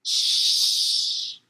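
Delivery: voiceless, sharp shush; a quick breathy "Shh!" through the teeth. A narrator's reading. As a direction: hush someone in a library. Shushing in library.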